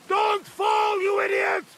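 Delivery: high, loud warning shout. Acted. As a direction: yell at him to stop. Friend yells a warning.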